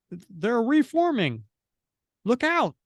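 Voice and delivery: high, urgent cry of warning; stretched, high-pitched comic-book warning; alarmed warning. Acted. Voicing a warning in battle.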